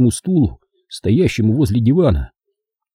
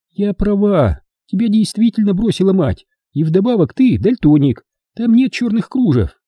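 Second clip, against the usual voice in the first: high female voice, needling him; mocking woman character; 10 semitones higher, 3 dB louder than usual. Hilda corrects her guest about the lace, high mocking woman's voice. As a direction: pitch it high as a woman scoring points.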